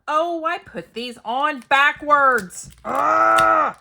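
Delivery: loud, high, frustrated wail with a groan; real annoyance at a crafting mistake, exclaimed slowly; exasperated loud cry ending in an ugh; frustrated outburst. Real, not acted. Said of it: "I'm sorry for my anger".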